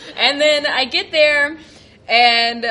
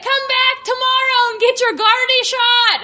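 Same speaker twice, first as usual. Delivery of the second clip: high nurse voice; 10 semitones higher than usual. Retold or imitated. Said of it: "And she was like".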